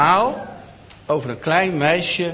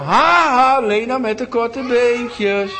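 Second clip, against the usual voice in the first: high, loud, slow schoolyard jeer; jeering call; 8 semitones higher, 5 dB louder, much slower than usual. A narrator's reading. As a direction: call it out to mock her. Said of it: "begonnen ze te roepen".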